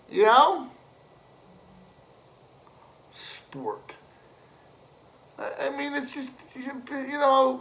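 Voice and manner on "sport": scornful whisper. Acted. Scornful repeat of a word.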